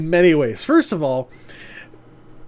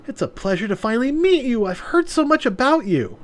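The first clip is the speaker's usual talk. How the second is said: sweet higher voice; 9 semitones higher than usual. Acted. Yuna greets Maya sweetly.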